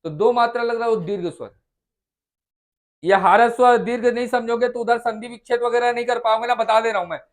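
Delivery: normal talking voice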